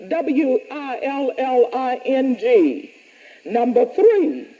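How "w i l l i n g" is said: W I L L I N G letter by letter, slow; spelled out. Shown as a demonstration.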